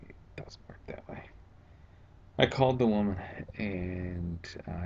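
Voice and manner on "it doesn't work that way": a dry whispered aside. Real, not acted. Telling viewers the agency is not nice, in a whisper. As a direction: breathe it out as a wry aside.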